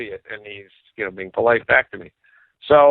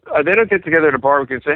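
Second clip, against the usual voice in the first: usual speaking voice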